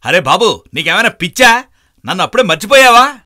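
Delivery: high, loud exasperated yell; shouting. Acted. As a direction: yell at him in disbelief. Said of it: "అలా అరుస్తున్నావు".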